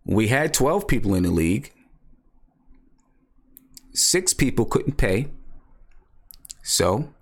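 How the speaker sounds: deliberate, with long pauses between sentences; slowed down. Real, not acted. Host repeating the league situation for viewers, slowed down. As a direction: spell it out slowly, piece by piece. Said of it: "I'm gonna slow down for you".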